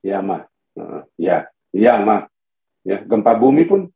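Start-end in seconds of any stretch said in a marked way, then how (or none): 0.1-2.3 low, minimal reply; phlegmatic husband. Acted. Phlegmatic husband answering his wife, low and unreactive. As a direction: lightly act a husband of few words. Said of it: "Nggak maunya banyak ngomong gitu".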